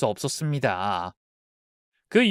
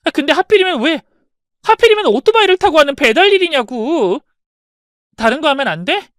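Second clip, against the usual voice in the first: loud, higher frustrated protest; exasperated outburst; 11 semitones higher, 8 dB louder than usual. Acted. Daughter protesting her father's motorbike job, loud exasperated. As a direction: vent the frustration.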